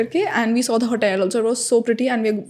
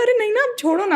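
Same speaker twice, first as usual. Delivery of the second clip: dismissive; 11 semitones higher than usual. Retold or imitated. Brushing off passport officer.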